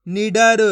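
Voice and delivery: "निडर" loud and deliberate, syllable by syllable; blended word demo. Shown as a demonstration. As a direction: model "निडर" with final "र" fully sounded. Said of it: "इसी तरीके से पढ़ना".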